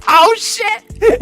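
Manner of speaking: very high mock panic squeal; freaked-out reaction. Young thief caught on voice chat, acted freak-out. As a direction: squeal it like you've been caught. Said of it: "And I freaked out".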